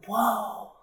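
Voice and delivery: ordinary delivery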